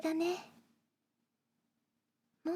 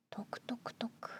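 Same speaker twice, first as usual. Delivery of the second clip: whispered pouring sound; 11 dB quieter than usual. Acted. Pouring milk sound words.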